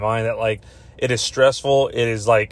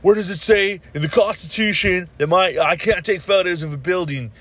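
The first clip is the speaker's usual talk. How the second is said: higher, put-on indignant voice; mocking people who film buildings, indignant higher voice; mock indignant filmer; 5 semitones higher than usual. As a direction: parody a smug constitutional know-it-all. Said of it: "these people are like".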